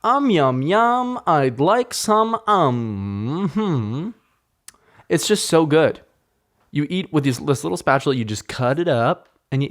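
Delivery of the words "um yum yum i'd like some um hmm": high, drawn-out yummy moan; dreamy food craving; yum-yum delight. Real, not acted. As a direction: savor it like you taste it again. Savoring food memory.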